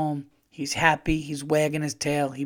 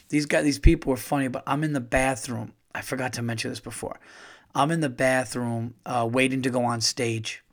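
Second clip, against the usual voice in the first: normal talking voice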